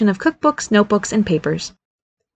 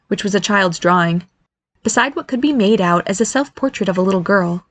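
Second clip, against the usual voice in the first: ordinary delivery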